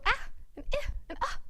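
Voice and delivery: "Ah", "eh", "ah" as short, cut-off vowel sounds; trapped short vowels. Shown as a demonstration. Teacher showing pupils closed-in vowels, short "Ah", "eh", "ah". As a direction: let "Ah", "eh", "ah" out as if trapped. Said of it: "They can just go".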